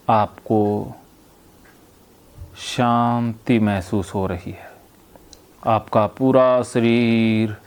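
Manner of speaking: slow, low hypnotherapist's voice with long pauses; hypnotic induction. Real, not acted. Therapist guiding a patient to breathe and relax, hypnotic induction. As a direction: guide them into relaxation, drawing words out, softening at phrase ends.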